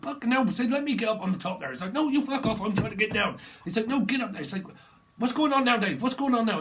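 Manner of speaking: loud, high bloke yells; two lads arguing on a ladder, shouting. Retold or imitated. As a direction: bark it, fed up and loud. Acted ladder squabble.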